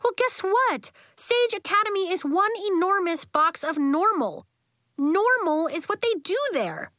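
put-on high, emphatic kid argument; animated, indignant retort; heated argument. A narrator's reading. Child arguing with best friend.

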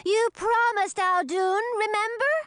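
put-on very high, insistent child voice; piping, childlike insistence; crying out. A narrator's reading. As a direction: plead like a bouncing, impatient little dragoness. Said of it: "Luli began bouncing up and down, crying".

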